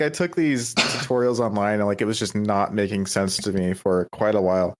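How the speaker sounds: usual speaking voice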